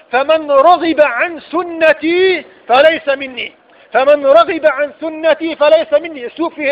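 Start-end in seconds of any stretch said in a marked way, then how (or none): 0.1-3.5 slow and weighty. Acted. Quoting the Prophet, repeated.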